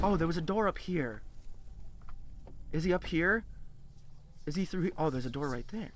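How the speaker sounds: low, barely voiced murmur; hushed dread. Real, not acted. Player hearing him nearby, hushed dread. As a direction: keep very quiet, afraid he's near. Listening for the monster.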